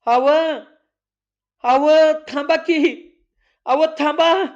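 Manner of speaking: high, loud, desperate calling out to stop him; loud cry. A narrator's reading. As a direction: shout after him in panic. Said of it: "Savitri मोठ्यानं ओरडली". (घाबरून मोठ्यानं हाक मारणं)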